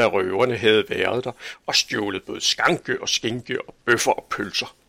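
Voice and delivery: plain reading voice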